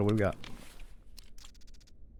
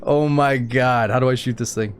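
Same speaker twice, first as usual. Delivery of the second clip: excited; 4 semitones higher, 8 dB louder, slower than usual. Real, not acted. Fumbling with the turret gun.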